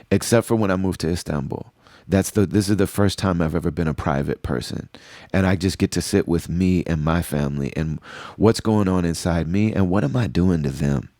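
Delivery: ordinary talk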